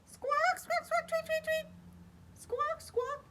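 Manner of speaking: high, squeaky squawks and tweets; bird squawks. Acted. Reading to kids, squawking high like a bird. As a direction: chirp like a little bird. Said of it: "he heard".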